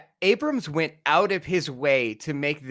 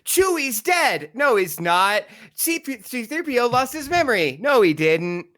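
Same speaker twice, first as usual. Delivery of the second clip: high mock announcements, each flatly knocked down; mocking exasperation; 6 semitones higher, 3 dB louder than usual. Podcaster ridiculing reversed plot twists, mocking and exasperated.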